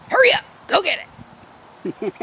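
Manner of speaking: high, loud excited call to the dog; urging the dog. Real, not acted. Owner urging her dog to chase a squirrel, high excited call.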